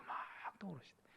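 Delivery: hushed, voiceless echo; awed whisper. Real, not acted. Preacher echoing the memory's return, awed whisper. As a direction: whisper it in wonder.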